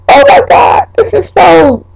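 aghast at her reflection; shocked. Real, not acted.

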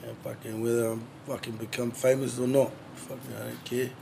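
normal talking voice

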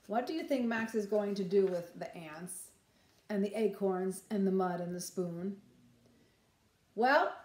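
plain reading voice

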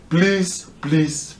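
high, cracking please; desperate real plea, pitched high; anguished pleading. Real, not acted. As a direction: beg with everything you have. Pleading with the nation.

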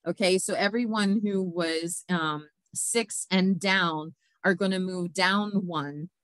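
ordinary talk, nothing marked

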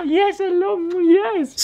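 very high sing-song dog greeting. Real, not acted.

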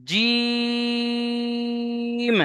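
jim held for three alif lengths; the long vowel held like Quran recitation; long drawn jim. Shown as a demonstration. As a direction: stretch জিম out long and steady. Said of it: "তিন আলিফ টান দিতে হবে".

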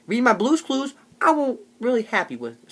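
normal talking voice

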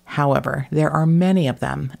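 usual speaking voice

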